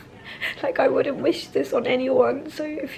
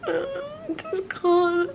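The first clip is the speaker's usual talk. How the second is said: ordinary delivery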